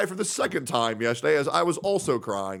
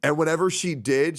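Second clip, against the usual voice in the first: ordinary talk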